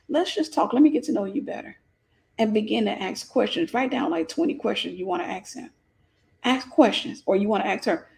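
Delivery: normal talking voice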